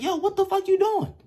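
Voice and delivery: high, loud incredulous challenge; retold outrage. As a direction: act out confronting a roommate mid-offence. Confronts roommate peeing on carpet.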